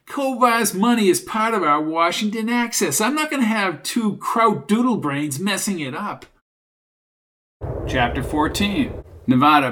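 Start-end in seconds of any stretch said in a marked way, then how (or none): 0.1-6.2 higher-pitched, irritated tough guy; Junior, brash. Acted. Junior refusing to let two men wreck the deal, brash. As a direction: insist hotly, pitched up.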